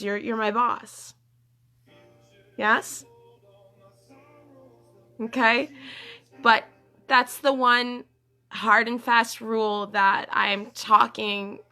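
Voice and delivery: ordinary delivery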